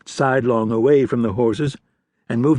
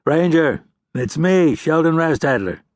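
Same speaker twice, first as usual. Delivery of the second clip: called down the street, a bit louder; calling out; 6 semitones higher, 3 dB louder than usual. A narrator's reading. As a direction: hail him from far off. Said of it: "a voice called out".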